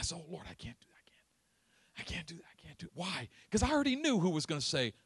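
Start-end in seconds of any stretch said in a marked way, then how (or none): 0.2-2.9 whispered refusal, trailing to nothing; fearful whisper. Retold or imitated. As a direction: shrink away in a frightened hush. Boy refusing God in fear.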